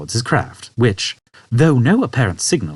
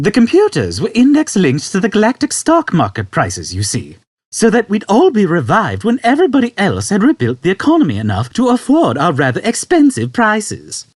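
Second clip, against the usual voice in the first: put-on high, louder old man voice; 11 semitones higher, 5 dB louder than usual. Old man explaining to Arthur, high and unconcerned. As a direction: explain it matter-of-factly.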